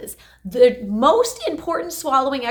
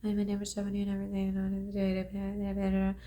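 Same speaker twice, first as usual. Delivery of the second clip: much softer, barely moving the mouth; tiny Parkinson's voice; 4 semitones lower, 13 dB quieter than usual. Shown as a demonstration. Therapist demonstrating underused throat muscles, very soft. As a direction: mumble it small and weak. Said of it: "my muscles in my mouth and throat weren't really working".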